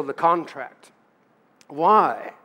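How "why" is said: normal talking voice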